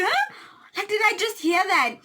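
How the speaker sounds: high, loud startled huh; shocked huh. Retold or imitated. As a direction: react like you can't believe it. Hearing the confession.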